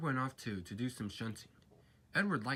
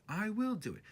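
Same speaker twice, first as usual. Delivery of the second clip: higher, determined chug with WILL stressed; Edward puffing; 10 semitones higher than usual. A narrator's reading. Edward pushing from behind, plucky puffing chant. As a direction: bounce it out like a plucky little engine. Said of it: "puffed Edward".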